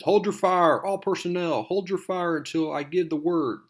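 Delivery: high, loud military barked command; commanding order. Retold or imitated. Officer shouting orders to all personnel, loud and high. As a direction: shout the order over rotor noise.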